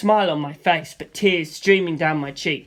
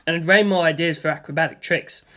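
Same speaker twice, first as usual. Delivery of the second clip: ordinary delivery, nothing marked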